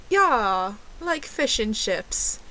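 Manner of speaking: slow, put-on British accent. Fake Brit confirming her order to the waiter, slow mock British accent. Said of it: "ordered my drink in a British accent".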